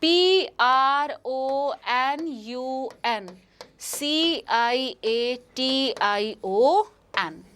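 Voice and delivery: letters dictated one by one, slowly; slow spelling out. Shown as a demonstration. Teacher dictates the spelling letter by letter, slowly. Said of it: "सही spelling है".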